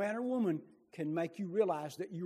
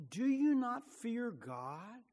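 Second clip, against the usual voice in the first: stern rebuke; 5 semitones higher, 4 dB louder, slower than usual. Acted. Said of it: "rebuked him".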